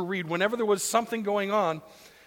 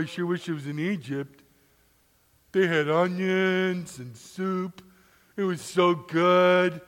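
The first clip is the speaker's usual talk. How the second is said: lower, complaining grumble, dragged out; whining Israelites; 3 semitones lower than usual. Acted. Israelites grumbling to Moses in the desert, whiny. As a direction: whine like thirsty wanderers.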